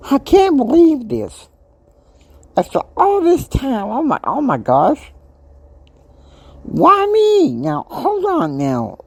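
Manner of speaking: why me, in rising despair; anguished disbelief. Real, not acted. Reliving shock at the diagnosis.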